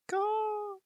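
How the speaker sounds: high sharp caw; a bird's croaking call; raven caw. Acted. Raven caws between sentences, high sharp cry.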